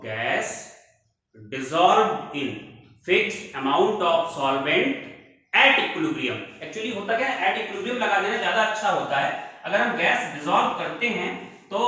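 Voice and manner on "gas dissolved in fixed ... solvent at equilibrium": very slow, spaced phrases; slow teacher dictation. Shown as a demonstration. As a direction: dictate the law for copying. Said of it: "कहता है".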